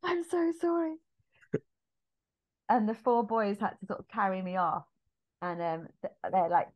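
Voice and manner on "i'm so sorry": very high, small pleading voice; mortified apology. Retold or imitated. Marius wiping Eponine's face, mock mortified apology.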